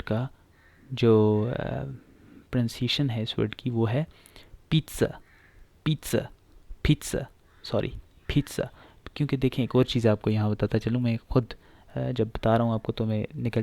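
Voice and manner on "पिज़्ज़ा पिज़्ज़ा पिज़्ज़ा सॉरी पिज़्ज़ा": पिज़्ज़ा said "pit-sa" with ts; sharp ts in the middle of पिज़्ज़ा; Italian pronunciation. Shown as a demonstration. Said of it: "उसी language के हिसाब से होगी".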